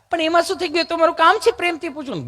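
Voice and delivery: high-pitched, put-on nurse voice. Nurse asking patient why he's nervous, high mimicked voice. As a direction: imitate the nurse, lifted well above your own pitch. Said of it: "nurse બેને પૂછવા".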